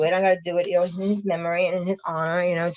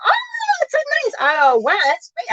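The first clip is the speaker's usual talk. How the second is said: excited. Real, not acted. Thrilled by viewer's comment.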